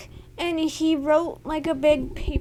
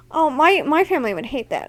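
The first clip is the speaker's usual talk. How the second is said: ordinary talk, nothing marked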